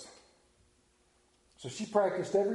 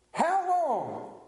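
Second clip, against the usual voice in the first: higher, pleading "How long?" with a falling end; high plaintive "How long?"; 10 semitones higher than usual. Acted. Pastor voicing people's impatience, high plaintive "How long?". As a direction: cry out "How long?" like a whining child.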